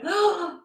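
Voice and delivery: high startled gasp; imitated waking gasp. Friend waking from a nightmare about Betty, acted startled gasp. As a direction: gasp as if jolted out of a dream.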